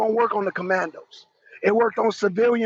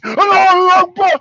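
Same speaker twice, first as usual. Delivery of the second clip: higher, much louder yell of Allahu Akbar; mock battle cry; 7 semitones higher, 9 dB louder than usual. Streamer mocking fighters with a sudden loud yell. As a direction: blast it out suddenly. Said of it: "Allahu Akbar is working".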